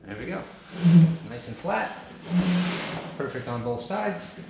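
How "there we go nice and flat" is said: usual speaking voice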